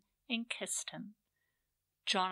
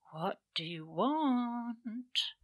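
slow, sensual purring voice; sultry purr; 3 semitones higher, 4 dB louder, much slower than usual. A narrator's reading. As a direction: purr it slowly and seductively. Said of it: "purred a soft voice that oozed sensuality".

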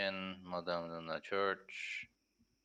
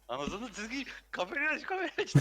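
much higher, louder frantic yelling; panicked yell; 4 dB louder than usual. Retold or imitated. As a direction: shout it in a panic. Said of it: "diye böyle".

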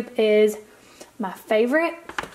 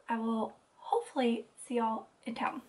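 whispering; 9 dB quieter than usual. Real, not acted. Signing off vlog segment quietly.

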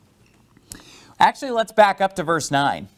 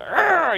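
teeth-gritting growl; 12 semitones higher than usual. Shown as a demonstration. Preacher showing the congregation an enraged teeth-grit, growling. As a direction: growl like a man grinding his teeth in rage. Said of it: "gritted your teeth like that".

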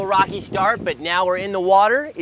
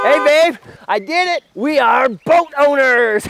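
higher, louder proud announcement; excited; 6 semitones higher, 6 dB louder than usual. Acted. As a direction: announce the big news, thrilled.

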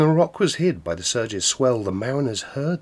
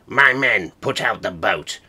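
commanding rover; 4 semitones higher, 8 dB louder than usual. Acted. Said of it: "Quoth he".

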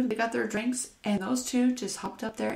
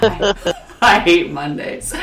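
hiccups; 12 dB louder, much slower than usual. Real, not acted. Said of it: "I got the hiccups".

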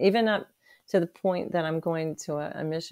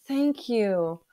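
higher and louder, bursting with gratitude; overwhelmed thanks; 7 semitones higher, 6 dB louder, slower than usual. Retold or imitated. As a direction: cry out thanks to God, much higher. Thanking the Lord in retold moment.

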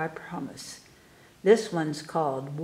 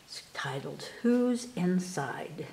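whispered title, hushed and mysterious; 7 dB quieter than usual. Real, not acted. Teacher whispering the poem title to the class, soft whisper. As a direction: breathe the title like a secret.

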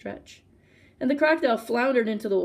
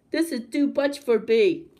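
higher, slow nasal complaint; through his nose; 4 semitones higher, 4 dB louder, slower than usual. A narrator's reading. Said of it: "he said through his nose".

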